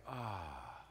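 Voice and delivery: soft, low contented "ah"; gentle sigh of relief; pleased sigh. Acted. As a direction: melt at a voice you love. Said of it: "and the voice is like".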